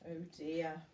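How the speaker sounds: usual speaking voice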